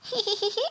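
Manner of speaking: put-on high, light girlish tee-hee; giggle. A narrator's reading. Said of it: "zachichotała Mandy".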